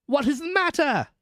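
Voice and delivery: high-pitched passenger's call; calling question. A narrator's reading. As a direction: ask across a distance. Passenger calls to messenger in mist.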